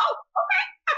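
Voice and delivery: a squeaky mock-shocked reaction; high-pitched mock surprise. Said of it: "And I'm like".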